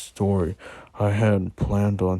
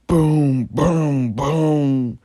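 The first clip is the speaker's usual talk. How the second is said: knocking sound; 6 semitones higher, 7 dB louder, much slower than usual. A narrator's reading. Knocking on bedroom door.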